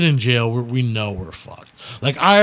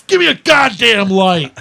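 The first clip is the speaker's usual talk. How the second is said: tough guy demand; 7 semitones higher, 6 dB louder than usual. Retold or imitated. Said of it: "play the tough guy role".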